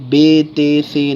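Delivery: "بے تے سے" with ay vowels; wrong Urdu letter names. Shown as a demonstration. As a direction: list the mistaken "بے تے سے" as bay, tay, say. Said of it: "نہیں پڑھنا ہے".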